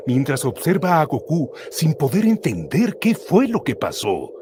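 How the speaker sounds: normal talking voice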